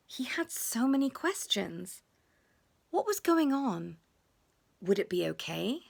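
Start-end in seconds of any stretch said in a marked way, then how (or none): none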